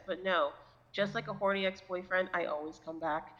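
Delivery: usual speaking voice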